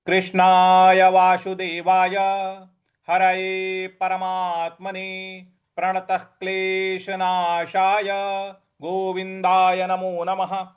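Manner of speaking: slow, measured Sanskrit recitation; chanted opening prayer; devotional invocation. Acted. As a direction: intone the prayer reverently.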